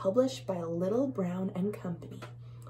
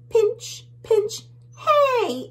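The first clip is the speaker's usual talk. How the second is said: very high, loud squeal; tiny bug; 7 dB louder, much slower than usual. Acted. Tiny bug protesting the pinchers, high and loud. Tiny bugs voiced reacting.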